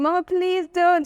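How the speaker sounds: put-on high, slow childish plea; child pleading. Girl begging her mum not to enroll her, high whiny plea. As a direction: beg like a scared little girl. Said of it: "I remember going".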